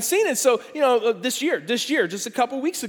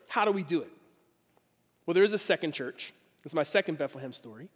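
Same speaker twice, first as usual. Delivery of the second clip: ordinary talk, nothing marked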